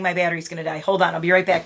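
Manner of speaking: ordinary talk, nothing marked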